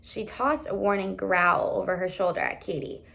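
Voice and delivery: ordinary delivery, nothing marked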